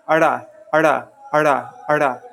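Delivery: "arda" with a short uh leading into a flapped sound; "arda", between D and R; retroflex flap demo. Shown as a demonstration. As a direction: demonstrate "arda", tongue flicking back to front. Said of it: "I'll make the sound so you can hear it".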